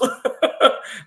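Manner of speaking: airless, unvoiced burst of laughter; real embarrassed laugh; breathy laugh. Real, not acted. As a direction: laugh with no voice behind it. Laughs at own story.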